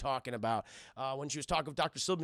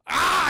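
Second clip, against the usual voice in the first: sudden loud high cry of pain; mock cry of pain; pained yell; 12 semitones higher, 12 dB louder than usual. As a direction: shout out as the hand snaps. John's hand breaks.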